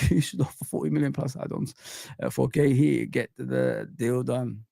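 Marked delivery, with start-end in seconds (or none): none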